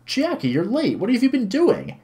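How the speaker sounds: very high-pitched reproach; exasperated waiting figure. Acted. Mister Mino waiting by the entrance, exasperated reproach. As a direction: demand to know the reason for lateness.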